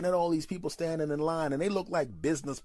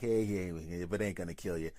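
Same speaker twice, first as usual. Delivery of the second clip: careless dude voice; 8 semitones lower, 4 dB quieter than usual. Retold or imitated. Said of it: "most guys are like".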